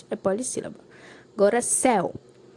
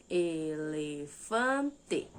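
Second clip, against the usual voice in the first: "Elefante" very slow and lower, four separate syllables; split into syllables; 5 semitones lower, 4 dB quieter, much slower than usual. Shown as a demonstration. Teacher confirming four syllables to pupils, "Elefante" slowly split up. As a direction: stretch "Elefante" into four held blocks, last syllable sounded fully. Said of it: "ele foi dividido em".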